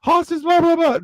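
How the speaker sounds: high, loud mock outrage; hammed-up indignant complaint; mock upset. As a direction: gasp and fuss like an offended listener. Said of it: "nobody get all upset and say".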